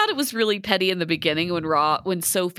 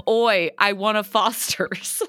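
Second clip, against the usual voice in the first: slow, broad imitation Australian; mock Australian accent; 2 semitones higher, slower than usual. Host showing co-host the context of oi, mock Australian accent. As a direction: put on a bad Aussie accent, drawn out. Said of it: "that's [name]'s Australian, South African, English".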